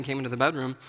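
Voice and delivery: normal talking voice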